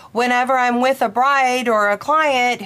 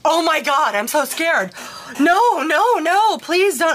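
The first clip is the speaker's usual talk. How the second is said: a retold client, mildly frightened and pleading, higher pitched; imitating panicked client; 7 semitones higher than usual. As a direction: act out her terrified begging, a little hammed-up. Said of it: "She freaked out and she's like".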